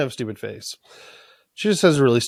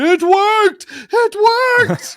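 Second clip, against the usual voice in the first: much higher, loud ecstatic shout; hammed-up Doc Brown triumph; mock triumphant cry; 6 dB louder, slower than usual. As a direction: yell it like a mad scientist. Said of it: "He's like".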